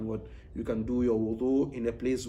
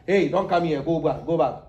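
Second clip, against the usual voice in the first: louder, brisk shooing-away calls; mock shooing; 2 semitones higher, 5 dB louder than usual. Teacher imitating strict scholars turning a woman away, mock loud. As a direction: wave her off with a stern go back.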